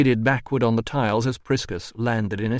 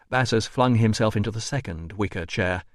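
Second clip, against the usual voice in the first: usual speaking voice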